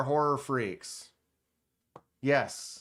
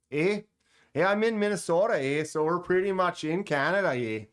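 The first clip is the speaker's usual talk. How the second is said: quick, high put-on Minnesotan with Canadian "eh"; Minnesota accent; 4 semitones higher, 3 dB louder, faster than usual. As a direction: ham up an upper-Midwest accent. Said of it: "Your accent imitation is her, the lady cop from Fargo".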